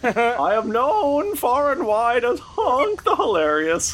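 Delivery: high squeaky clown voice; whiny high-pitched clown; silly clown. Acted. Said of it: "That is pretty good".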